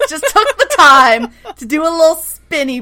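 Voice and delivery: amused disbelief bubbling over; real laughter over the words; laughing. Real, not acted. Laughing at the drama.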